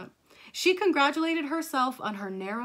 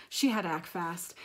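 ordinary delivery, nothing marked

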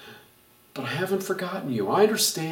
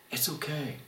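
reassuring; 10 dB quieter than usual. Pretend. Repeated reassurance.

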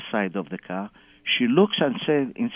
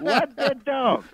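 high, drawn-out baffled question; exaggerated disbelief; 10 semitones higher, slower than usual. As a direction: play innocent in a high, stretched voice. Repeats the feigned ignorance, bigger.

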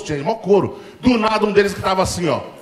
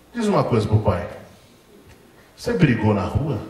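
a father setting a trap; calm stern father; 7 semitones lower, 3 dB quieter than usual. Retold or imitated. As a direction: lower calm questioning father. Father calmly interrogating son.

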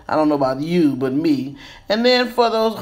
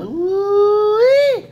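excited ooh-wee; 13 semitones higher than usual. Real, not acted. Reveal of the finished hairdo.

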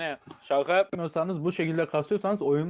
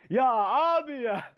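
Berke; 8 semitones higher than usual. Retold or imitated. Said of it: "Şimdi Berke olsaydı".

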